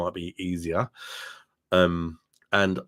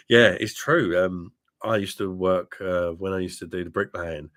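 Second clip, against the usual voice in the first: ordinary talk, nothing marked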